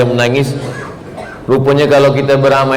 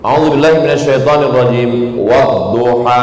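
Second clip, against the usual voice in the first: Quran recitation; 3 semitones lower than usual. Acted.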